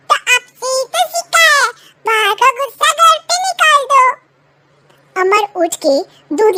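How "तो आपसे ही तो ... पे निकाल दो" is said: put-on higher boy's voice, talking back; a boy throwing father's words back; cheeky child. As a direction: son sassing his father.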